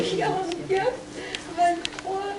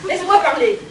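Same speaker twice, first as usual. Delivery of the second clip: fâchée; 6 dB louder than usual. Acted. Mother scolds aunt on phone.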